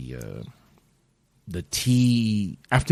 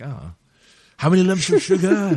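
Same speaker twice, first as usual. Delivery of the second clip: mock British tea-house speech, higher and louder; posh British accent; 9 semitones higher, 4 dB louder than usual. Playing a tea-house customer, mock posh British accent. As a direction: put on a hammy British accent. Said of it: "I have a terrible British accent".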